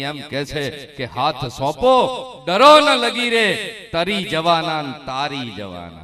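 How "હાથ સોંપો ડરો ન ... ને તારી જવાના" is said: poem recital at full force. Acted. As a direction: ring the lines out to the crowd. Declaims a poem.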